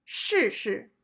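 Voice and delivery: 试试 said shì then light neutral shi; first syllable falling, second short and weak; teacher demo of 试试 with a falling then neutral tone; Mandarin tones. Shown as a demonstration. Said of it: "The first word".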